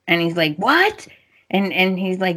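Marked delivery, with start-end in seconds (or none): 0.6-1.1 high, rising mock surprise; retold shocked "what", pitched way up; startled question. Carter's friend hears she is gone, startled. Zende learns Paris is gone.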